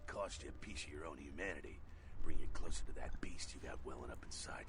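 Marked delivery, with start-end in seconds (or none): 2.3-4.6 low, menacing whisper of warning; ominous whisper. Acted. Mentor warns the fledgling of the beast, whispering low. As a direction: breathe the threat of the beast.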